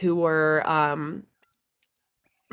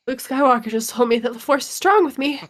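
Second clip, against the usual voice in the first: awestruck; 6 semitones higher than usual. Retold or imitated. Rob stunned after meeting Luke.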